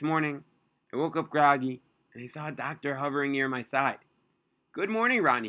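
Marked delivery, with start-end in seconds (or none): 4.7-5.5 bright, much higher hello to a patient; cheerful. A narrator's reading. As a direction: greet him with a lift in the voice. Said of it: "he said cheerfully".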